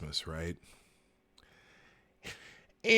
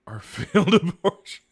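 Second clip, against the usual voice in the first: breaking into laughter mid-line; laughing; 5 semitones higher, 9 dB louder than usual. Real, not acted. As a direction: laugh through the punchline. Cracks up at own joke.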